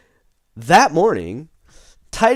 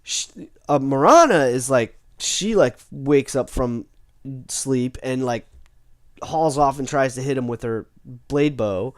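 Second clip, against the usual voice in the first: usual speaking voice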